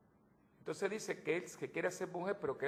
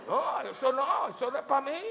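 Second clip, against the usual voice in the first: mock disgust; 10 semitones higher, 7 dB louder than usual. Preacher acting out his own disgust at his reflection, mock horror.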